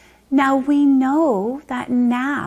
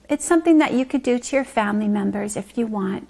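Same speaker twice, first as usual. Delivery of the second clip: ordinary talk, nothing marked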